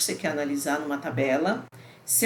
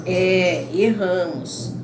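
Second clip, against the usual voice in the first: "É" louder and firm, "erramos" drawn out slowly; confirming intonation; 4 dB louder, much slower than usual. Retold or imitated. Teacher quoting Ana's confirming answer, slow and firm. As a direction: stress "É", then stretch "erramos". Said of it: "você percebe pela entonação".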